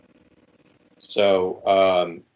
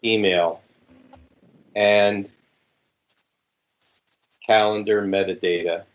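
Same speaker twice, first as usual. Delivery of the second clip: dictating. Shown as a demonstration. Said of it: "Okay, I am ready again".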